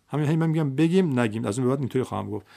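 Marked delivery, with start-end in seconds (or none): none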